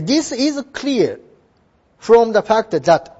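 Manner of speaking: slow, deliberate English phrase; slow model reading. Shown as a demonstration. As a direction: spell it out slowly for the class. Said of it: "いいですよ". (ゆっくり区切った模範読み)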